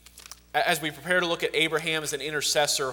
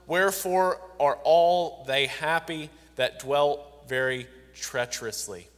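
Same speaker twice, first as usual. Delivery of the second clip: solemn pulpit reading of a lament; scripture reading; 5 dB louder than usual. Acted. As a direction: voice the prophet's complaint with gravity. Reads Jeremiah's complaint.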